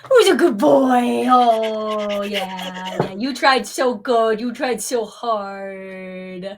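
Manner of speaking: loud, gushing praise for a pet; cooing at a dog. Acted. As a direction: coo over the good boy.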